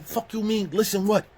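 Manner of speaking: high-pitched furious challenge; angry yelling. Retold or imitated. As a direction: demand an answer, outraged. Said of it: "I don't realize I'm yelling".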